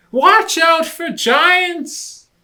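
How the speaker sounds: high, slow, loud cry of alarm; shouted warning. Acted. Rabbit warns Lion in the dark, high alarmed shout. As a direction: yell it out like a scared little rabbit.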